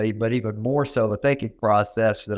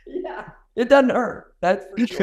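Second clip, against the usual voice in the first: laughing; 11 semitones higher than usual. Real, not acted. Laughing at joke about accents.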